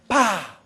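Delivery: single short popping blast; imitated small explosion. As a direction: mimic a small charge going off. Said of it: "we hear small explosions going off".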